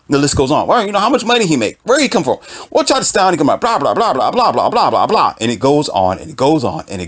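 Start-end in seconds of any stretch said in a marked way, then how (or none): 0.7-5.3 higher, fast prying questions trailing into blah blah; nosy commenter. Retold or imitated. Imitating gossiping commenters, higher and dismissive. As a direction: rattle off the nosy questions, mocking.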